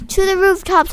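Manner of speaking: high, loud yell. A narrator's reading. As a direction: shout the command. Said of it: "I yelled".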